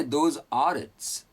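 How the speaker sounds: each word said slowly and separately, "Those are its"; slow careful. Shown as a demonstration. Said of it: "Good English". (धीरे-धीरे, हर शब्द अलग)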